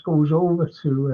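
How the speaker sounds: ordinary talk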